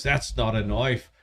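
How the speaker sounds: low, slow Aussie movie quote; mock Australian accent. Host repeating the film line to co-host, put-on Australian accent. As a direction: drawl it as a hammed-up Australian. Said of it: "Every single time somebody says".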